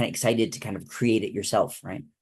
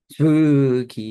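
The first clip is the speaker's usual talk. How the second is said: drawn-out eerie ghost voice; spooky voice; 3 semitones higher, 7 dB louder than usual. Acted. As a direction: say it like a haunting ghost. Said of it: "are we being spooky quietly".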